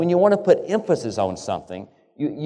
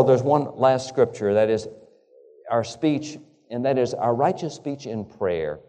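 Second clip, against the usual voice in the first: usual speaking voice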